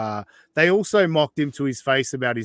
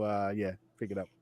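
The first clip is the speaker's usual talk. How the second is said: ordinary talk, nothing marked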